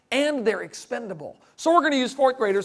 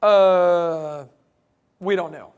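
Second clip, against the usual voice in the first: lower, hesitant shrug of a voice; mock clueless scientist; 5 semitones lower, 4 dB louder, much slower than usual. Mocking the evolutionist who can't answer, lower and unsure. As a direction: play the stumped expert, lower. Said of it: "You know what he'll say?".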